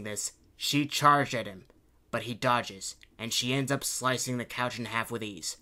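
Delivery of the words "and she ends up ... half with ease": usual speaking voice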